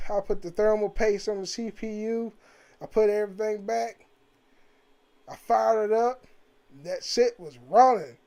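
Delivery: usual speaking voice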